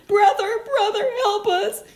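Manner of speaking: high, loud begging; pleading pigs. Acted. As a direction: cry for your brother's help. Pigs beg brother for help.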